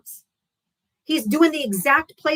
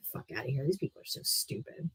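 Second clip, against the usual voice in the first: muttered aside; 8 semitones lower, 15 dB quieter than usual. Real, not acted. Muttered aside after prank caller.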